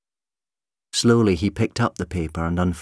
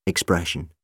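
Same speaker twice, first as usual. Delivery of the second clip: plain reading voice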